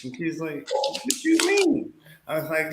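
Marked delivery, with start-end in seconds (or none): 0.7-1.9 puzzled grandma. Retold or imitated.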